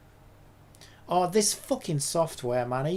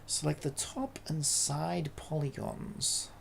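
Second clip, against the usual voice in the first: slow, quiet, lower-pitched reread; 5 semitones lower, 9 dB quieter, slower than usual. Real, not acted. Rereading the tutorial step to themself, slow and quiet. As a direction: read it back slowly and softly to yourself.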